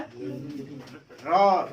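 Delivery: र with the tongue rolling; rolled र. Shown as a demonstration. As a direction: demonstrate a rolled र. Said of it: "आपके जीभ जो है घूम रहा है".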